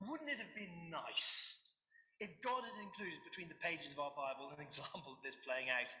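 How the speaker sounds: ordinary talk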